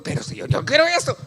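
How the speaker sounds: high, loud, fast protest; mock stubborn insistence. Acted quarrel with God. (insistencia terca, aguda y rápida)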